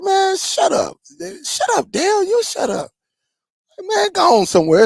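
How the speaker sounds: squeaky mock friend brushing him off; imitating friend. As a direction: play the buddy shooing you away in a high voice. Friend voiced telling him off.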